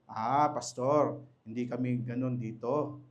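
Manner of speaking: mock excuse to pastor. Member defending self to pastor.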